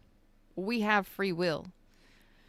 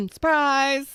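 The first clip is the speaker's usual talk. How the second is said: high, loud and drawn out in glee; spring it on them like a present; surprise reveal; 7 semitones higher, 8 dB louder than usual. Acted. Reader unveiling a gift to the viewer, high, drawn-out surprise.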